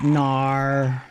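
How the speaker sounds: a short goofy grunt like a dim-witted guy; dumb voice. Acted. Streamer mocking Jonas, goofy dumb voice. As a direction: play it like an idiot. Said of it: "I wanna hear Jonas sound like an idiot".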